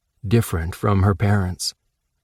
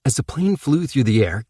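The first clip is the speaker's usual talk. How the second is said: usual speaking voice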